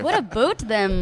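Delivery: lightly done Scottish-Canadian blend; Scottish-Canadian accent. Acted. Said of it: "half Scottish, half Canadian".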